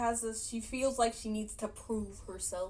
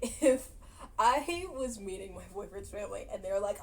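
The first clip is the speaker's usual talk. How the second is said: normal talking voice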